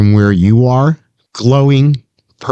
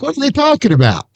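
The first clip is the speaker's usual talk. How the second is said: high, quick puzzled mimicry; high-pitched, hurried confused question; puzzled character's question; faster than usual. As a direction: voice a baffled adventurer, pitched way up. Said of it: "[name], you're like".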